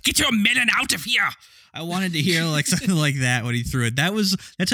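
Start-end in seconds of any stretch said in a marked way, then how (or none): 0.0-1.3 a hint of a childish German accent; mild mock German accent, yelled at the melon thief. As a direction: bark it out lightly in a German accent, like a bratty child. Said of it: "saying in a German accent".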